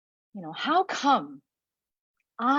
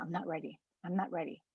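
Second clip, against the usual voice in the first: quiet refusal; 3 semitones lower, 9 dB quieter, faster than usual. Retold or imitated. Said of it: "And I keep saying".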